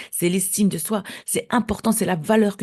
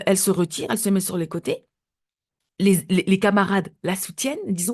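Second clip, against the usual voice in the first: ordinary talk, nothing marked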